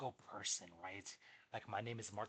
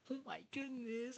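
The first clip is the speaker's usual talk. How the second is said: very high, drawn-out mock freak-out; tweaking out; 14 semitones higher, slower than usual. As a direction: squeal it slowly, freaking out. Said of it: "I start tweaking out".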